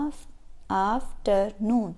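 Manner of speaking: afternoon very slowly as "af", "ter", "noon"; split into syllables. Shown as a demonstration. Teacher showing multi-syllable words, afternoon split syllable by syllable. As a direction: break afternoon into three separate syllables. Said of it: "ये multi syllables हैं".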